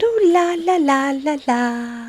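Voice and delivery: high airy la la la fading down; mock her as twee and breezy; mocking sing-song. Cohost teasing the narrator's rosy telling, sing-song. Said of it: "She makes it sound".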